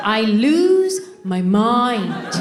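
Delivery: very slow, hammed-up outburst; comic exasperation. Wife driven mad by husband's chewing, hammed-up exasperation. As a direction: stretch each word as if losing it. Exaggerated rage at chewing noise.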